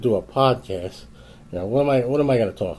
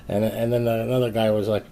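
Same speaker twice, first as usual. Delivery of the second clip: ordinary talk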